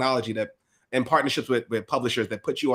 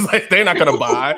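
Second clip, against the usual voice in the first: louder, laughing through; 4 semitones higher, 9 dB louder than usual. Real, not acted. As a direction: chuckle as you say it. Joking about family on Instagram.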